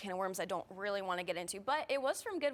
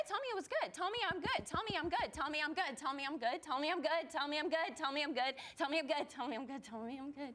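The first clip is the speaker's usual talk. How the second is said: pleading begging; 4 semitones higher than usual. Acted. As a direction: beg over and over for approval. Begging therapist for praise.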